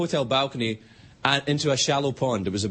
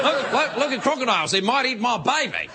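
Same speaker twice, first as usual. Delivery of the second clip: high-pitched Australian showman parody; imitating a thrilled crocodile handler, pitched high; mock crocodile showman; 6 semitones higher than usual. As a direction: ham up an excited, high-voiced croc wrangler. Mocking the man dangling his baby.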